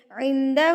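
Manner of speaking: عندہ with the final "hoo" held long; drawn-out ending. Shown as a demonstration. Showing students small madd, عندہ with long held ending. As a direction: stretch the last vowel of عندہ for three beats. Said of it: "تین حرکات کے برابر کھینچیں گے".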